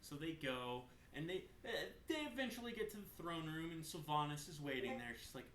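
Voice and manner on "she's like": usual speaking voice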